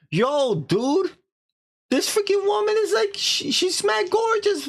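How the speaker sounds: very high, hammed-up excited voice; mock-excited rave. Man excitedly tells his advisor about woman.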